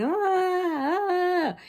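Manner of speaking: high squealing play screams; mock screams. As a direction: squeal high to scare the kids. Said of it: "me and Callahan are screaming".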